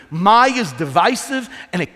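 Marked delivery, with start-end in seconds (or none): 0.1-0.5 "my" said loud and pointed; stressed my. Shown as a demonstration. Pastor tells the congregation, hitting "my" loud. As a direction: punch the single word "my". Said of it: "listen to me".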